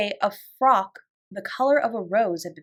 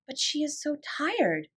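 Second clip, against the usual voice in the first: slow, soft, higher pitied murmur; tender thought; 6 semitones higher, 4 dB quieter than usual. A narrator's reading. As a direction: linger on "tired" with pity. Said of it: "she thought".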